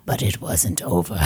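very low, softened, comically ominous Muppet-like voice; intended intimidating voice. Pretend. Host tries to intimidate everybody, low Muppet-like spooky voice. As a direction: drop way down for mock menace. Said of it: "That was supposed to intimidate everybody".

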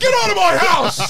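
high, loud mock outrage; furious parent yell. Comic plays parent kicking out child, yelling. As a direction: bellow it like a parent evicting a kid.

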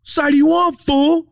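high, loud challenge; mock serious confrontation. Retelling O G confronting a fan, mock serious. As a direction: imitate a fan getting in someone's ear. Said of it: "in his ear, serious".

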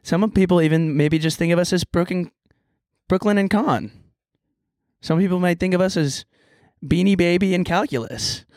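bright intro announcer read; podcast host intro. Acted.

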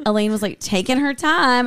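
ordinary talk, nothing marked

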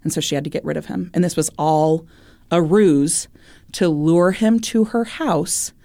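ordinary talk

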